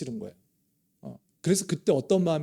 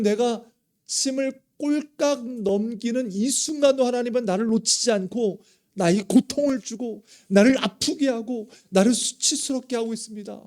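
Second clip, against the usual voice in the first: higher, louder anguished outcry; Job's lament cry; 6 semitones higher, 5 dB louder than usual. Retold or imitated. Pastor voicing Job crying out to God in anguish. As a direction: wail it like a man in despair. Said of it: "탄식하면서 이렇게 외치잖아요".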